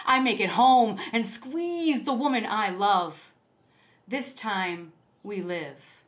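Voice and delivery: incantatory recitation, measured refrains and rising runs; poetry reading. Acted. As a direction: recite as a poem, climbing in intensity towards the end. Recites a poem.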